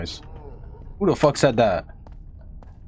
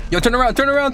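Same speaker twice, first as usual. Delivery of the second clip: panicking gamer; 9 semitones higher, 3 dB louder, faster than usual. Real, not acted. Monster behind teammates.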